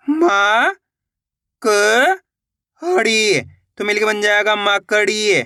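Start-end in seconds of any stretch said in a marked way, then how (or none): 0.1-3.4 म, क, हड़ी, with clear gaps; syllable-by-syllable sounding out. Shown as a demonstration. Teacher breaks down a word for learners, म, क, हड़ी one by one. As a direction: stretch म and क singly, then हड़ी. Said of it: "एक एक अक्षर का उच्चारण करके".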